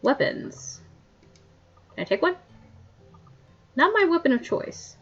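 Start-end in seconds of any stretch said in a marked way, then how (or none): none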